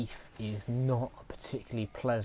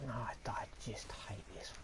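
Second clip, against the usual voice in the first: whispered irritated grumble; frustrated whisper; 9 dB quieter than usual. Real, not acted. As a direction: hiss it under breath, annoyed. Frustrated at dying.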